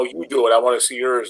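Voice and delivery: ordinary talk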